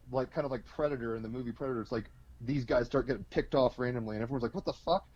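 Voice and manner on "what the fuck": high-pitched mock astonishment; baffled shock. Everyone sees enemies picked off, baffled high exclamation. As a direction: blurt it out high, in disbelief.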